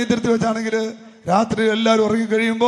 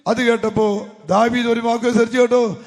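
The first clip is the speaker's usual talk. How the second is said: ordinary talk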